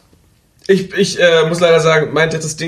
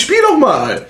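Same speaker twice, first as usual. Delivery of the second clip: teasing urge; 8 semitones higher, slower than usual. Retold or imitated. Mock gift-giver urging to play.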